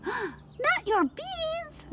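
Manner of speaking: high, very slow, stretched outraged wail; puppy aghast that his beans are gone; outraged cry. Acted. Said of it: "cried Scapito, outraged".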